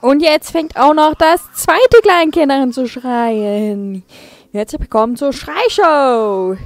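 loud, slow, drawn-out show opener; booming game-show welcome; hyped intro. Acted. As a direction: shout the welcome like a TV host over screaming babies. Loud let's play intro.

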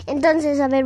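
usual speaking voice

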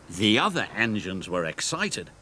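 usual speaking voice

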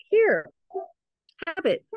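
put-on high-pitched baby voice; imitated baby. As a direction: pipe up high, as a baby handing something to dad. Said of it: "he's going like this to his dad".

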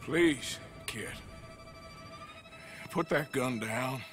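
low, careful talking-down; careful calming plea. Acted. Talking armed boy down.

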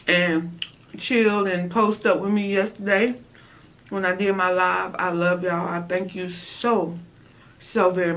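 muffled behind hand; talking while eating; chewing with hand over mouth. Real, not acted. Seen 4: Hand covers chewing mouth from 5.7s; earlier cheeks full, chewing.

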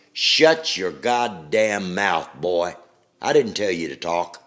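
put-on loud, slow, furious bark at a boy; angry superintendent. Superintendent silencing a new boy: slow, loud angry bark. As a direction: hammer each word out, loud and slow.

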